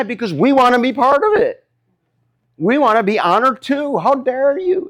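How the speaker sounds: high, aggrieved acted protest; mock indignation. Preacher as disciples wanting honor too, indignant. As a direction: act out left-out followers demanding their share. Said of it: "They're mad".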